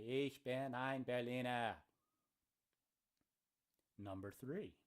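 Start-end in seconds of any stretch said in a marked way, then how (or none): 0.1-1.7 German in a broad Boston accent; Kennedy-style Boston accent on German. Shown as a demonstration. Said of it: "my best Boston accent while speaking German".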